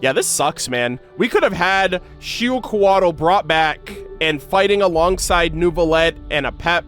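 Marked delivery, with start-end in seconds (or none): none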